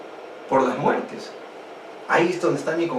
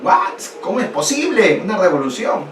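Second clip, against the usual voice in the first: high, startled cry of disbelief; the king can't believe his ears; shocked king; 5 semitones higher than usual. Acted. King Louis hears of the revolution: high shocked disbelief. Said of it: "Luis dieciséis se quedó".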